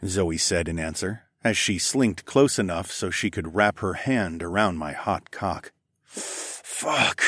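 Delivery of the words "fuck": whispered hiss under the breath. Acted. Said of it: "I hissed".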